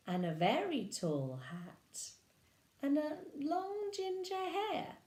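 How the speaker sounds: lively storybook narration; reading to children. Acted. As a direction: read it like bedtime to little ones. Reading picture book aloud.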